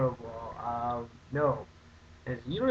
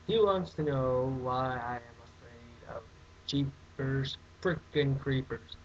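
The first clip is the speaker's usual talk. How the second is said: ordinary talk, nothing marked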